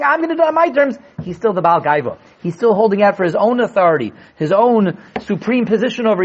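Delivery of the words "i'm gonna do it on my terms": 1 defiant Paro. Pretend. Voicing Paro, raised.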